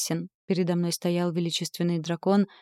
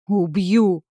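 louder murderous bellow; furious roar; 7 dB louder than usual. A narrator's reading. Acheron roars at the heroine before throwing her, furious bellow. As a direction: roar out the threat. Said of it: "взревел Ахерон".

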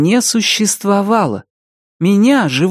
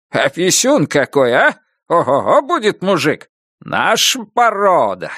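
proud grandpa. Acted. Grandfather boasting over baby.

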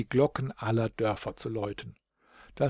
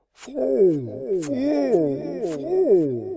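long, high, loud echoing call; hallendes Echo; 12 semitones higher, 5 dB louder, much slower than usual. A narrator's reading. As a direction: let each call ring out from the mountain and fall away. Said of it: "die hallende Antwort aus der Ferne". (hallendes, lang gezogenes Bergecho)

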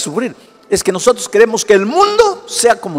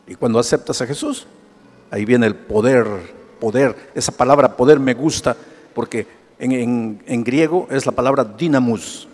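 much lower and softer, intimate sermon; hushed preaching; 7 semitones lower, 4 dB quieter than usual. Acted. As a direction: confide the good news quietly. Hushed preaching over music.

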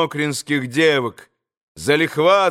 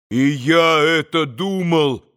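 plain reading voice

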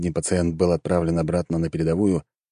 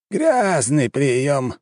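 high, very drawn-out verdict through pursed lips; tight-lipped; 7 semitones higher, much slower than usual. A narrator's reading. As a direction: stretch it slowly, lips pressed. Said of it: "сжал губы император". (протяжно, поджав губы)

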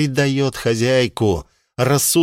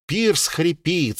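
ordinary delivery

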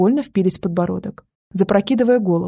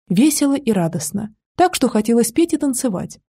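ordinary delivery

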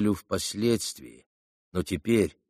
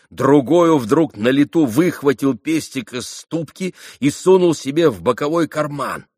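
ordinary delivery

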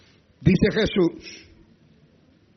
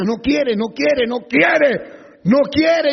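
rising from firm to loud; building emphasis; 6 semitones higher, 5 dB louder, much slower than usual. Acted. Preacher to congregation, each repeat louder. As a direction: hammer each no quiere harder.

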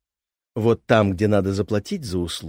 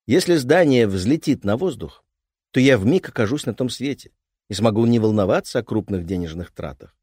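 ordinary delivery

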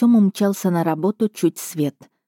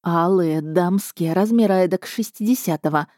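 usual speaking voice

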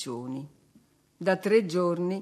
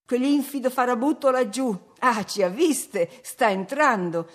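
louder, higher indignant reply; heated; 5 semitones higher, 5 dB louder than usual. A narrator's reading. Nelly pointing out the scoundrel to Catherine, heated. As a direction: let the scorn boil over. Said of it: "risposi accalorata".

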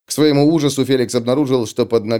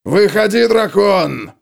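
bellowed challenge, high and loud; yelling; 8 semitones higher, 4 dB louder, slower than usual. Acted. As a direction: roar it out at the dragon. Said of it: "завопил он".

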